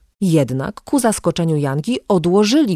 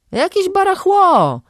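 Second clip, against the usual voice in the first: high, slow, dismissive voice of a street guy; man in tracksuit; 4 dB louder than usual. A narrator's reading. Tracksuit guy dismissing the junk, high and drawn out. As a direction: sneer at the junk like a know-it-all. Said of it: "stwierdził fachowo facet w dresie".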